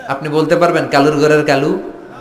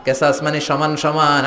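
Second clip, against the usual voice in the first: normal talking voice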